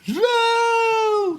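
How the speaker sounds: very high, loud scream; mock scream. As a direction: scream like a defeated fighter. Victim reply to villain.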